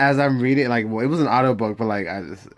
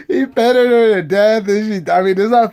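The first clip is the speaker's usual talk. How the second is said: laughing; 11 semitones higher, 9 dB louder than usual. Real, not acted. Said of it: "laughing through it".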